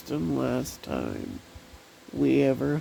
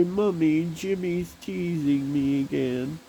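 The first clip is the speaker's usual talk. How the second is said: put-on higher, whiny little girl tattling; crying child; 6 semitones higher than usual. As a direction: imitate a child complaining to mom. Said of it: "I would cry and say".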